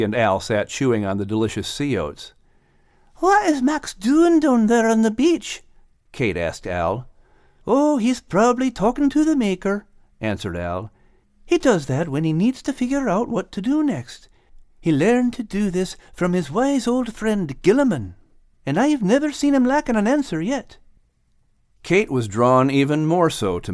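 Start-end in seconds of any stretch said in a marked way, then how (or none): 3.2-5.6 put-on high, bright young female dog voice; Kate. A narrator's reading. Narrator voicing Kate asking Al about Max, high-pitched. Kate's voice in story. 7.7-20.8 high, eager sidekick voice. A narrator's reading. Al telling Kate about Max, in a high voice. Al's voice in story.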